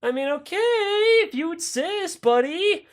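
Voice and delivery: very high, softened comic voice; reluctant compliance. Acted. Troops uneasily accepting Castro's order, comic high reluctance. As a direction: give in hesitantly, way up high.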